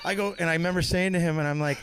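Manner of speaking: ordinary talk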